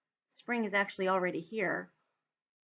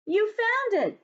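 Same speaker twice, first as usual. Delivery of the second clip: much higher, louder joyful cry; cried out; 12 semitones higher, 5 dB louder, slower than usual. Acted. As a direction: burst out in delight. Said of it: "cried Toad".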